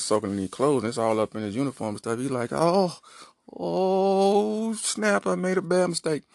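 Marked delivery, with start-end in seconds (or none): 2.5-6.2 high, drawn-out dawning alarm; comic high-pitched wail of regret; mock dismay. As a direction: realize your mistake, hammed-up. Officer feels the drug hit.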